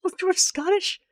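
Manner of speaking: incredulous. Real, not acted. Incredulous question.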